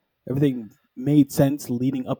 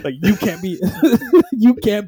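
higher, louder scolding broken by laughter; laughing mock scolding; 6 semitones higher, 8 dB louder than usual. Retelling Vision banishing his wife, laughing mock scolding. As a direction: crack up while playing outraged. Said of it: "he scolds her".

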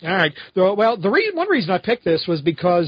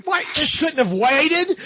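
higher, slowed mock irritation; imitating annoyed wife; 9 semitones higher, slower than usual. Wife answering his call, mock irritated. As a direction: sound put out like a wife interrupted. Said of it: "I feel like I'm talking to my wife".

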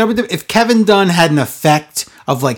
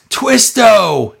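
higher-pitched mock wrestler's yell; 6 semitones higher than usual. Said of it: "Hardy yells".

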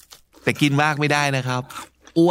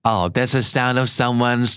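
slow model; much slower than usual. Shown as a demonstration.

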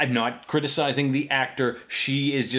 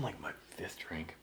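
whisper; 8 semitones lower, 18 dB quieter than usual. Real, not acted. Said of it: "I'm on".

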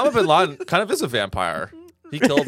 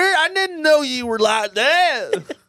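fake cowboy accent; 10 semitones higher than usual. Cowboy welcoming him.

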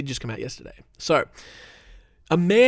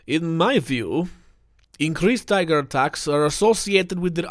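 put-on failed Russian accent; 3 semitones higher than usual. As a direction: try a Russian accent, then give up. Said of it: "I can't do a Russian accent".

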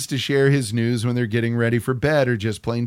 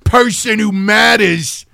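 ranting, much higher and louder; angry husband; 8 semitones higher, 7 dB louder, slower than usual. Retold or imitated. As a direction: yell it like a spoiled man. Angry husband yelling at wife.

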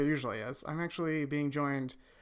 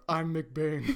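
McBain voice; 2 semitones lower than usual. Acted. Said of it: "I got into character".

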